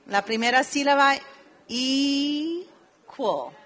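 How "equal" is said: equal drawn out in clear English, falling at the end; slow English model word. Shown as a demonstration. Teacher modelling a word for students, drawn out. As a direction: model equal slowly for the class. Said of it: "la palabra de dos sílabas".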